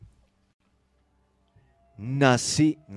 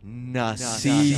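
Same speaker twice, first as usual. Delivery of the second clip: slow echo voice; 6 semitones lower, much slower than usual. Shown as a demonstration. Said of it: "nacida con eco".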